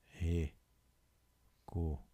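very slow, broken into syllables. Shown as a demonstration. Teacher writing the answer word for pupils, syllable by syllable. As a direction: say "Recomenda" with long pauses between syllables.

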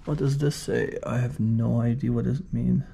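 ordinary delivery

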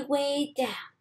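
usual speaking voice

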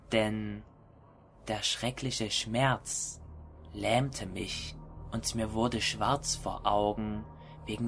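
low, hushed horror story narration; hushed creeping horror. A narrator's reading. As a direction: read it like a creeping, dark confession. (leise, schleichende Horror-Erzählung)

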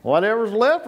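ordinary talk, nothing marked

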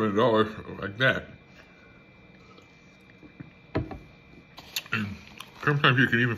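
talking before sip; speaking as cup rises. Real, not acted. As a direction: cup near lips. Seen 3: cup held aside, raised to lips near 6.3-7.2 s.

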